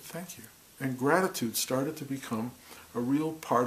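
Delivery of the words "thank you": very soft, nearly breathless thank you; hushed thanks. Retold or imitated. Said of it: "I just said".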